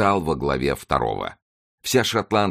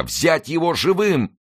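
high, drawn-out command; shouted order; 12 semitones higher, slower than usual. A narrator's reading. English soldiers order a capture, high drawn shout.

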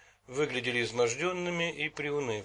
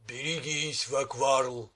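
booming monster voice; slower than usual. A narrator's reading. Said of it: "прогудел громовой голос".

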